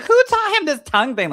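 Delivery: amused outrage. Real, not acted. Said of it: "I'm like".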